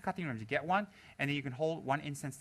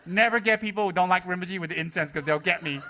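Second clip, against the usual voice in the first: ordinary delivery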